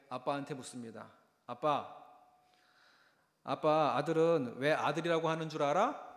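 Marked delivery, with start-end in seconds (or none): 1.5-6.1 크게 부르는 아이 목소리; 아빠를 조르듯 부르며. Retold or imitated. (크게 아빠를 부르는 아이 흉내)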